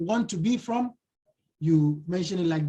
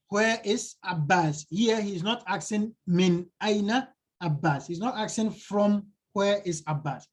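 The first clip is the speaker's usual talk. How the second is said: ordinary talk, nothing marked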